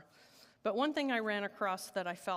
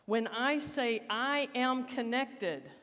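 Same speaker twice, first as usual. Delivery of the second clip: louder, slow, rousing calls; more energy; 3 semitones higher, 3 dB louder, slower than usual. Shown as a demonstration. Asking the sleepy congregation for more energy, loud and rousing. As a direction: pump the room up with each line. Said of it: "A little more energy".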